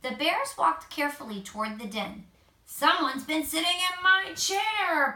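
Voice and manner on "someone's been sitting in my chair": higher, louder mock growl; growling Papa Bear. A narrator's reading. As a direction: grumble at the disturbed chair. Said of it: "Papa Bear growled".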